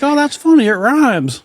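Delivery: imitating the Southern store lady; old lady voice. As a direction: chirp it like a friendly old store clerk.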